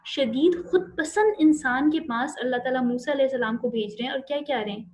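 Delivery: ordinary talk, nothing marked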